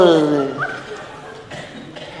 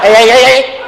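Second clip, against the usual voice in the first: sudden loud, higher hey hey; loud playful hey; 7 semitones higher, 6 dB louder, faster than usual. Acted. Teasing lover loudly fends off a kiss, playful shout. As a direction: call out to stop them, half laughing.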